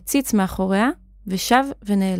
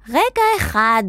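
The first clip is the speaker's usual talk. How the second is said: high-pitched urgent cry; acted shrieked call; Zan shouting; 7 semitones higher, slower than usual. A narrator's reading. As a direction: shout high to halt someone. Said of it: "צעקה זן".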